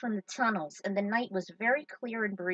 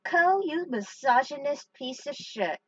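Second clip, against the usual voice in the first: a hint of a louder, higher friend voice; mock-indignant quote, only lightly voiced; imitating Clement; 5 semitones higher, 5 dB louder than usual. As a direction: toss off the friend's insult with a lightly voiced sneer. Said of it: "As Clement once said".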